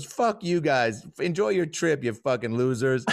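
high-pitched mock parting insult; upset gal. Gal walks through the laughing group, mock upset. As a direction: imitate her huffy send-off, starting drawn out. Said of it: "now she's upset".

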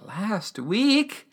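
imitated shocked query, rising high; emotional disbelief. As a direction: echo it as a stunned question. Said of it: "things get super emotional".

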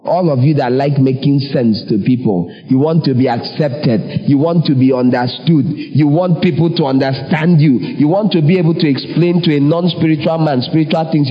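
ordinary talk, nothing marked

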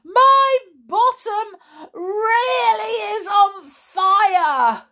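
high, loud, desperate wail; panicked scream. A narrator's reading. Boy with smoking pyjamas, panicked scream. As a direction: wail it out in pain.

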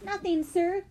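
put-on high, deferential boy's reply; polite. A narrator's reading. Henry answering the angry man, polite and high. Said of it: "Henry said politely".

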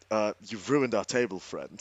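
low, soft deadpan, dropping away on the last word; quietly unimpressed, in character; understated complaint. Acted. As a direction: understate it, voice sinking to a quiet finish. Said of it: "I'm like".

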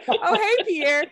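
high, excited greeting; bright mock greeting. Greeting Pierre at Starbucks, in a high excited voice. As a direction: call out a high, cheery hello.

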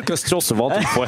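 put-on light Belgian accent with a laugh. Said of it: "Avec l'accent belge". (accent belge rieur)

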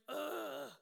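loud, drawn-out "ugh"; exasperated groan. Retold or imitated. Mother hearing her daughter keep crying, exasperated groan. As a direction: groan at being dragged out of bed.

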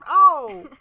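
loud, high mock yell; imitated cry of oh. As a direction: cry out like the person in the video. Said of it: "And you hear it then".

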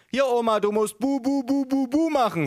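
put-on very high baby-babble voice; someone coaching grandma. A narrator's reading. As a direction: coo the syllables high and monotone. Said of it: "sagte jemand hinter mir".

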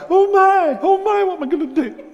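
put-on high, squeaky, panicky little boy; mock-terrified David facing Goliath, squeaking high; frightened shepherd boy. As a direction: play the scared little shepherd boy, hammed up.

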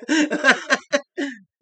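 high, loud laughing fit; hearty laugh. Real, not acted. Guest laughing as his friend apologizes for him, loud and high. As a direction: burst out laughing.

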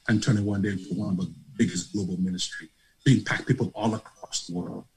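retelling his wife's words while fighting tears; choked up. Real, not acted. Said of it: "I'm getting choked up".

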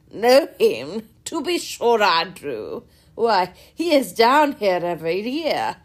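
loud, booming, jovial squire; loud hearty country gentleman. A narrator's reading. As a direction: boom it out like a cheerful host. Sir John voiced by reader.

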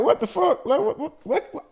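high, stunned what the fuck; retold disbelief. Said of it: "And I was like".

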